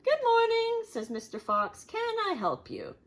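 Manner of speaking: high, bright shopkeeper greeting; a hint of an eager salesman. Mister Fox greets the pigs in his shop, high and eager.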